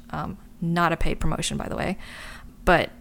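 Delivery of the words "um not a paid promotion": usual speaking voice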